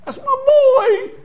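high, drawn-out cry of recognition; acted overjoyed, tearful wail; tearful father. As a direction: cry out slowly as you spot your child. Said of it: "I can see him now crying".

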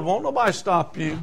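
normal talking voice

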